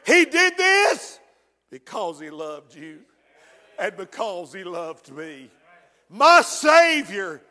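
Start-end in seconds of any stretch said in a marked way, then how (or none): 1.7-5.5 much softer and lower, tender confession. Real, not acted. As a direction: drop it down, gently sincere. Tender drop after climax.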